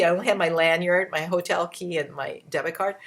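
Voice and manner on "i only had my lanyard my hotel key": ordinary talk, nothing marked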